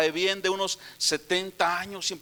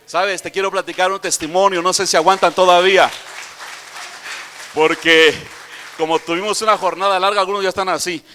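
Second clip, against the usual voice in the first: louder preaching push to a tired crowd; 7 dB louder than usual. Acted. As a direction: rouse the sleepy congregation, loudly. Preacher loudly addresses tired crowd. (predicación fuerte para despertar a la congregación)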